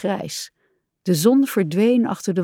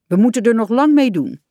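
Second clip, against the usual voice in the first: higher, louder admonition; stern; 4 semitones higher, 5 dB louder than usual. A narrator's reading. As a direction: lay down the rule firmly. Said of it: "zei hij streng".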